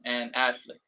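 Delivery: normal talking voice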